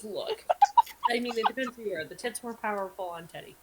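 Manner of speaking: normal talking voice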